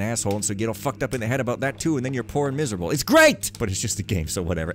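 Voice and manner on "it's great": loud, high mock cheer; sarcastic outburst. Real, not acted. Player ranting about the game's moral, sarcastic shout. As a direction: burst out in fake enthusiasm.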